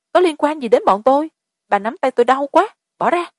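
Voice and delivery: high, pained protest; pained cry. A narrator's reading. A woman gripped too hard, crying out in pain. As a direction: cry out as your arm is crushed. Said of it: "kêu lên thảm thiết".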